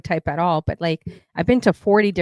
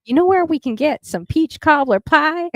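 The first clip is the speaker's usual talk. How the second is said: put-on thick Southern accent; 7 semitones higher, 5 dB louder than usual. Asking strangers in North Carolina, hammed-up Southern accent. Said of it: "We tried doing the thickest Southern accent".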